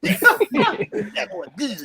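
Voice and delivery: high, stretched mock praise; playful hype. Host hypes co-host's singing, playful high-pitched cheering. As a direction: cheer on a singer, drawing words out high.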